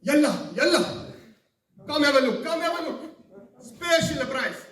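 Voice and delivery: high, very loud hawker, words stretched out; pushy market seller's cry; souk vendor calls. Acted. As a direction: holler for customers across the market. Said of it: "what's the first thing you hear".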